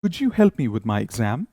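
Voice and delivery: slow polite request; slow model sentence. Shown as a demonstration. Teacher reads a polite request for students to repeat. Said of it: "ध्यान से सुनिए और मेरे पीछे repeat करिए".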